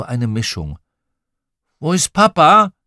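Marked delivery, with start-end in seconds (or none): 1.8-2.7 put-on high, loud grumpy child; a child's grumpy demand; mild growl. A narrator's reading. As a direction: grumble it out, pitched up and loud. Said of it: "knurrte Tom Tiger".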